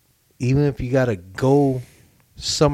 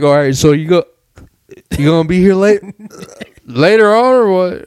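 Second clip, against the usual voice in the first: loud, higher, hammed-up host; mock party host; 7 semitones higher, 8 dB louder than usual. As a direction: call it out over the party. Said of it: "Be like".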